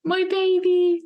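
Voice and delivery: high, tender, welling up; an overwhelmed first touch of the baby; emotional. Retold or imitated. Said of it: "I started to get emotional".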